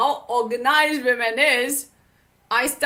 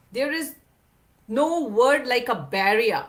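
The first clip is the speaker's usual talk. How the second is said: usual speaking voice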